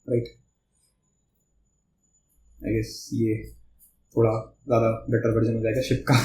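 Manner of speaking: low, soft, half to himself; absent-minded undertone. Real, not acted. Teacher drawing a ship and bridge example, low and absent-minded. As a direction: talk quietly as you sketch, with long pauses.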